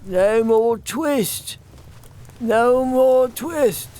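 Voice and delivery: slow, rising, despairing dream talk; feverish mumble. Acted. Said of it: "in his dreams he mumbled".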